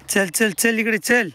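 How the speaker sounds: drawn-out high call to the calf; slow calling. Real, not acted.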